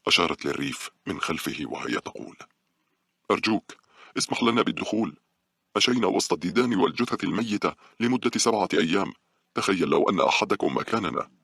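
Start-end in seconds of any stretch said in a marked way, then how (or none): 3.3-11.3 higher-pitched, insistent appeal; urgent, imploring mother; desperate pleading. A narrator's reading. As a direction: beg the guard to imagine your ordeal. Mother begging guard to let them in.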